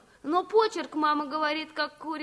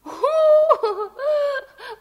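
loud, high, gleeful giggle; a child laughing at her guest's boast; burst of laughter; 8 semitones higher, 9 dB louder than usual. Acted. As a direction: break out laughing at the silly idea. Girl laughs at baking bread.

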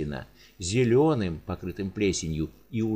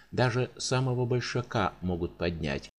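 plain reading voice

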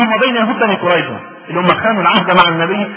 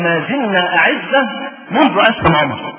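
slow quote; slower than usual. Acted. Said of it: "نقف مع الحتة دي".

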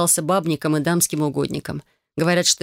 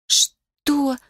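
a shocked, higher rasped "what"; hoarse gasp; 9 semitones higher than usual. A narrator's reading. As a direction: croak it out in shock. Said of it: "прохрипела я". (прохрипеть в шоке)

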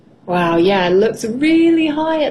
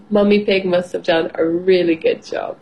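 ordinary delivery

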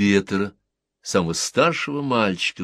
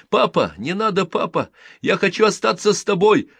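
put-on high, loud pleading child's cry; a small boy clinging and begging not to be left; 13 semitones higher, 5 dB louder than usual. A narrator's reading. Boy begs the soldier he thinks is his father, high and pleading.

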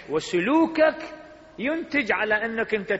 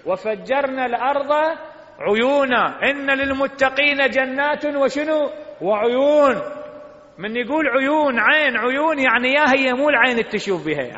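full-force preaching; 7 semitones higher, 7 dB louder than usual. Acted. Preacher reciting the verse to worshippers, at full force. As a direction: proclaim the verse to the hall.

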